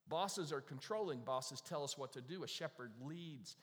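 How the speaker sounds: usual speaking voice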